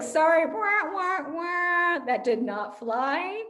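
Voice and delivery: singing a sad wah wah wah, then flat; hammed-up sad trombone sound; mock sad trombone. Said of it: "You were beautifully dramatic".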